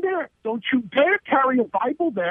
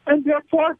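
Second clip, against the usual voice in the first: ordinary talk, nothing marked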